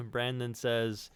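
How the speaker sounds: ordinary talk, nothing marked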